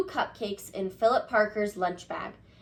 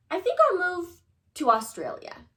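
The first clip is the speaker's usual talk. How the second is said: put-on sulky kid; 7 semitones higher than usual. Kid's sulky wish.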